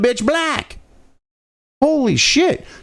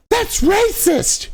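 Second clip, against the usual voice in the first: much higher, slow, hammed-up shriek of offense; mock outraged pearl-clutcher; 14 semitones higher, much slower than usual. Mocking offended critics crying racism in a high, slow voice. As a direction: play the scandalized critic, overdone. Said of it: "the Pearl Clutches went".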